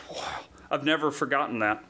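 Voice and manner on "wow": hushed retold amazement; very soft, wondering aside; awed whisper. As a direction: whisper it, stunned by what was heard. Retelling his awed reaction.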